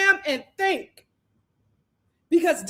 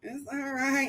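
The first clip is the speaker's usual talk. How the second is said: softer, hushed fake soothing; soft mock comfort; 11 dB quieter than usual. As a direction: pat his back and coo it, half-hearted. Quoting Kenzie comforting crying Dylan.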